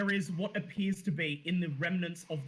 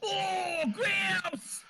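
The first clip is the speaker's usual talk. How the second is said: high, whiny Cockney rat in a flap; squeaky Cockney rat voice; panicky Cockney rat; 12 semitones higher than usual. Acted. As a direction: wail like a fretting street rat. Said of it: "says the rat".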